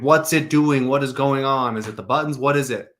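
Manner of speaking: imitated frustration. Coach imitates the call taker questioning a customer, mock-frustrated. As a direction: imitate an exasperated call taker pressing for answers. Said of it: "the C S R was getting frustrated".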